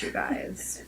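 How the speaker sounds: whispered aside; breathy secret whisper. Real, not acted.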